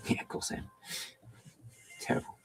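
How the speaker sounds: breathy whispered admission; whispered aside to the viewers. Real, not acted. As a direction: whisper it as a guilty confession. Admitting talking to cat.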